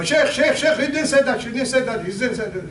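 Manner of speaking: mock the eager people calling "Sheikh, Sheikh"; eager interrupting. People interrupting the sheikh with excited complaints. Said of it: "Like some people go".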